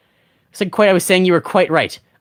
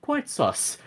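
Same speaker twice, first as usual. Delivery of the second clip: high, hushed British accent; soft, high-pitched mock British; 6 semitones higher, 9 dB quieter than usual. Shown as a demonstration. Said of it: "Quite sus in a British accent".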